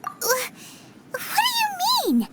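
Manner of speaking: jumps up high in disbelief; a sudden, high-pitched what; surprised. Acted. Paimon startled by Yanfei's claim about the hairpins, surprised.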